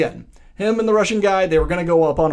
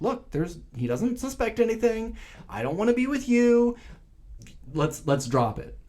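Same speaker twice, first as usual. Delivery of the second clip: lightly voiced bride, higher pitch; higher-pitched retold brush-off; imitated brushing-off; 10 semitones higher than usual. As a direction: dismiss him in a raised, put-on voice. Voices bride dismissing the best man.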